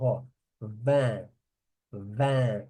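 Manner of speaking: "vin" said with a strongly nasal vowel, no n sound; teacher modelling "vin" with a full nasal vowel. Shown as a demonstration. Teacher shows students the nasal "vin" slowly. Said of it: "Esse I N é bem nasal".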